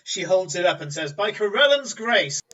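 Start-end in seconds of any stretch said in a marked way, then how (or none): 1.1-2.4 proclaiming. Acted. Merchant proclaims while holding bauble up.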